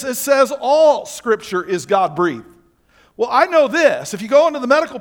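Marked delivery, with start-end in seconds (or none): 0.0-2.5 "all" leaned on, slow and loud; slow emphatic reading with "all" punched; stress on all. Shown as a demonstration. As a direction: hit "all" hard and slow. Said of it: "Now notice it says this".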